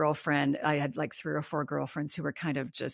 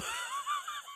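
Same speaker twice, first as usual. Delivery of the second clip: short high laugh; 10 dB quieter than usual. Real, not acted. Laughs at being mad at friend.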